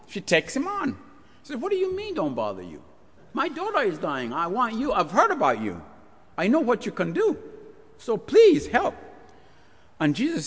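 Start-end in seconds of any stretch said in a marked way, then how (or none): 1.6-8.9 high, desperate pleading; pleading mother. Acted. Mother begs Jesus to heal her daughter, high and desperate. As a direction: beg for your dying daughter.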